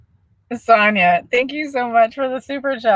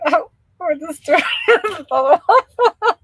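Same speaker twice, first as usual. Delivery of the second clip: reading breaks into high giggles; real giggle fit mid-sentence; laughing while reading; 8 semitones higher than usual. Real, not acted. Reading a viewer's question, breaking into laughter. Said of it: "I'm not gonna be able to stop laughing".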